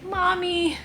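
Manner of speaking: high mock kitten cry of mommy; imitated kitten crying. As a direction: voice the baby kitten calling her. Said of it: "He's like".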